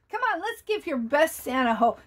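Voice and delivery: usual speaking voice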